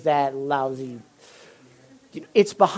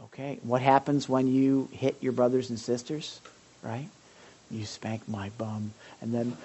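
ordinary delivery

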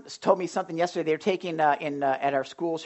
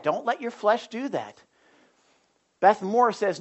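ordinary talk

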